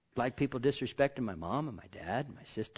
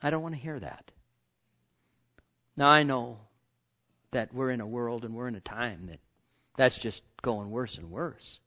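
ordinary delivery

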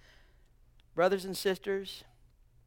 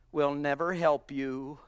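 usual speaking voice

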